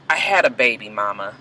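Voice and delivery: drops to a soft, hushed confession to her mother; quiet confession. Retold or imitated. Daughter confessing to her mother, hushed and broken. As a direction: let it fall away, barely there.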